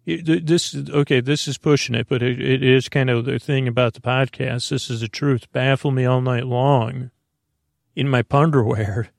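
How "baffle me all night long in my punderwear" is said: ordinary talk, nothing marked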